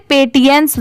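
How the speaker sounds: पेटियंस read letter for letter as pe-ti-yans; learner's misreading. Shown as a demonstration. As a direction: give पेटियंस a t sound, as a poor reader would. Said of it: "जिनको नहीं आता पढ़ने वो इसे".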